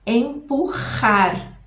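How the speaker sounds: Empurrar drawn out very slowly, the rr a throaty jota; slow Spanish jota. Shown as a demonstration. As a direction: stretch every sound, scrape the j from the throat. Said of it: "presta muita atenção a esse som".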